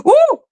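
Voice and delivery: squealed high ooh, hammed up; a playful ghostly squeal; mock spooky ooh. Mock fright reaction.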